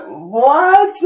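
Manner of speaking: very high, loud startled yelp; shocked what. Retold or imitated. Hearing Belen is in South America, a startled yelp. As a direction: yelp it in total disbelief.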